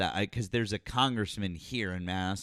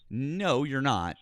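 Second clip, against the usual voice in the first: ordinary talk, nothing marked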